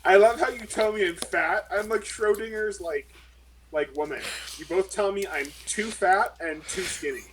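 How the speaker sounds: normal talking voice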